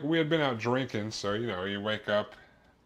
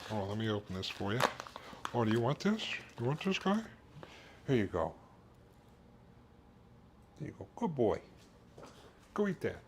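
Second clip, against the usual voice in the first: talking to the dog; 5 semitones higher, 7 dB quieter than usual. Real, not acted. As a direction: coo at the little dog with a treat.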